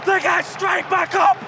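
high, loud, thrilled play-by-play; excited shouting commentary. Real, not acted. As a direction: shout the call to the fans. Live commentary after equaliser.

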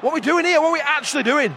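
real fed-up fan's outburst; exasperated complaint. Real, not acted. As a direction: demand it loudly in frustration. Exasperated at team's play.

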